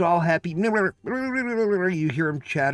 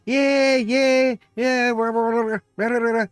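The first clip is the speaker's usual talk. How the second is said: chattering Kerbal; 6 semitones higher than usual. Retold or imitated. Imitating the Kerbal flying toward the moon, high excited chatter. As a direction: rattle off happy nonsense like a tiny astronaut. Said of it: "You hear that little Kerbal chattering?".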